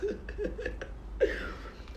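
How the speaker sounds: quiet airy giggle; soft breathy laugh. Real, not acted. Eating on camera, a soft airy giggle. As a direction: laugh softly under the breath.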